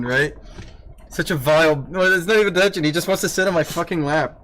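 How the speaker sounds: normal talking voice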